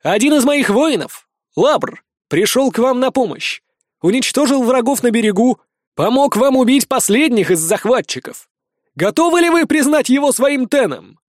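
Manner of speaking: high, ringing challenge to a crowd; rousing leader's speech. Acted. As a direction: throw the questions out to the crowd.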